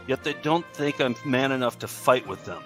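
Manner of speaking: normal talking voice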